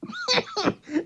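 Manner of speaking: squeaky giggle; high laugh. Real, not acted. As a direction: giggle helplessly. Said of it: "oh, I can't speak".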